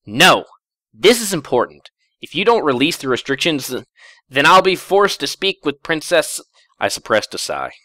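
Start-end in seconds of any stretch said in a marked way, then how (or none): 0.1-6.4 high, loud indignant protest; angry outburst. Acted. Twilight refusing the narrator, loud angry protest. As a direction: stamp your foot and refuse, loud and high. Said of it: "She stomped a hoof".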